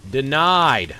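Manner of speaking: loud, triumphant denial; gloating shout. Real, not acted. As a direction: crow it over the enemy. Gloating at blocked enemy.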